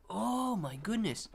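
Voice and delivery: ordinary talk, nothing marked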